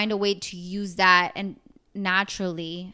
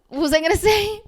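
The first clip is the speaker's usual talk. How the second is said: laughing; 11 semitones higher, 7 dB louder, much faster than usual. Real, not acted. Losing her train of thought.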